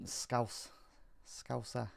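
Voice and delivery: hesitant Liverpool accent attempt; tentative Scouse accent. Shown as a demonstration. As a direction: test a Liverpool accent under your breath. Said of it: "I can't do a Liverpool accent".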